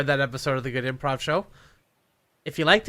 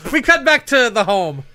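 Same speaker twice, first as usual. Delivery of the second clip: slow, loud, raised narrator voice; projected improv narration; scene announcer; 6 semitones higher, 7 dB louder, slower than usual. Acted. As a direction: announce the scene change grandly.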